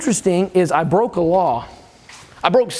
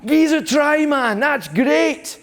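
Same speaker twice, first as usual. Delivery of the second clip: put-on much higher, thrilled teenage cheer; excited kids; 8 semitones higher, much slower than usual. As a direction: sell the kids' delight, pitched way up. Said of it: "They're excited".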